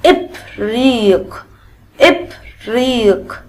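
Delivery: إبريق slow, opening on a short "i"; kasra example word. Shown as a demonstration. Teacher giving children a kasra example, إبريق sounded out slowly. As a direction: stretch إبريق out slowly, syllable by syllable. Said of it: "زي مثلًا كلمة".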